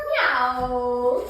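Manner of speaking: loud, drawn-out playful meow; cat meow. Pretend. Mom answering what cats say, playful cat meow. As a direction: meow like a cat for a small child. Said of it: "what do cats say?".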